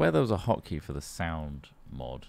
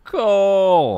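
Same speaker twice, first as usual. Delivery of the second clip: "Coal!" yelled much higher and louder; excited shout; 11 semitones higher, 14 dB louder than usual. Real, not acted. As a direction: cheer it back at full volume. Echoing shout.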